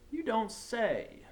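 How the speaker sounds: high, slow mock surprise; sarcastic drawl. A narrator's reading. Disguised teacher teasing the principal, sarcastic. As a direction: draw it out with sarcasm.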